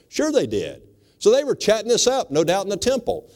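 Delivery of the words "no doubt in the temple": usual speaking voice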